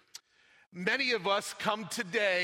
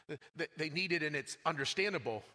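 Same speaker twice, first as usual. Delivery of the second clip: normal talking voice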